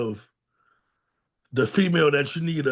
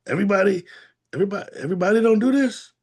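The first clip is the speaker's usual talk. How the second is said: incredulous; 6 semitones higher, much faster than usual. Retold or imitated. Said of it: "It's like".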